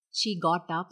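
plain reading voice

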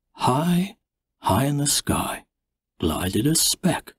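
plain reading voice

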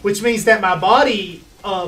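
normal talking voice

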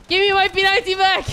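high, agitated outcry; frantic demanding. Real, not acted. Gamer wants his taken gun back, frantic demand.

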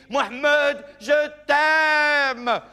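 high, loud cry of love; shouted love confession. Acted. Girlfriend shouting her love at Mohamed, high and loud. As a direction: blurt it out at the top of your voice. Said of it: "elle lui dit".

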